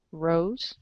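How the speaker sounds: ordinary delivery, nothing marked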